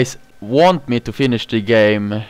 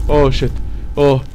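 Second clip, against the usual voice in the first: ordinary talk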